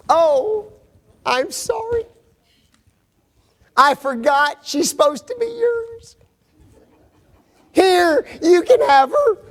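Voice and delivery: high, sing-song fake-sorry voice; mock sarcastic apology. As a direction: hand her over with exaggerated fake politeness.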